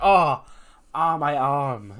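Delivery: hammed-up yelp of hurt; feigned pain. [name] stops the count faking injury, mock pained.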